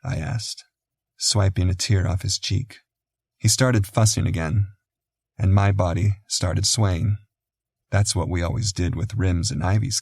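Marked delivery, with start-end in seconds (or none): none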